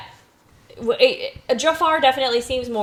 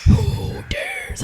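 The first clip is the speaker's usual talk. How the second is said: Cave of Wonders voice. Retold or imitated. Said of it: "here's [name]".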